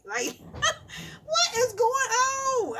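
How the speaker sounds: high, slow laughing cry of disbelief; baffled laugh at the grocery bill. Real, not acted. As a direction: laugh out the question.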